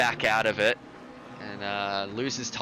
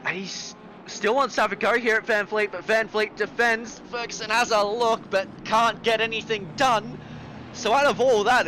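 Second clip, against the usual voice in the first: motorsport commentator; 9 semitones higher than usual. Real, not acted. Live call continues.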